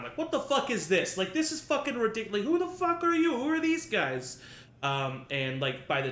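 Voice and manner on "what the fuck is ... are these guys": loud, high ranting prisoner throwing a fit; yammering fit. Acted. Prisoner Roy throwing a fit at guards, loud high rant. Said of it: "just yammering the whole time".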